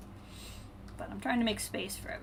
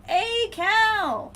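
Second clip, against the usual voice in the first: much higher and louder, a cheery shout; excited call-out; 12 semitones higher, 8 dB louder than usual. Real, not acted. Streamer spots viewer Cal in chat, calling out high and loud. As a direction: sing out the name like spotting a friend.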